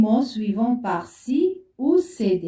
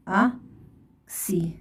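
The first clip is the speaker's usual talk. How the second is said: "أكسي" split into ak and si with a long pause; slow syllable dictation; 3 semitones lower, 4 dB quieter, much slower than usual. Shown as a demonstration. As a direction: say "أكسي" slowly, one syllable at a time. Teacher dictates syllables while writing.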